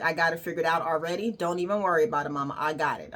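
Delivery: quiet, fast, breezy reassurance; brushing off her mama's worry; quick, soft "I got it"; calm and assured. Retold or imitated. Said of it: "I was like".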